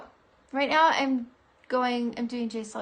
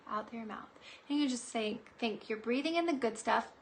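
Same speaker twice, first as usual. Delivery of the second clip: guided breathing; 6 dB quieter than usual. Acted. Leads a breathing exercise.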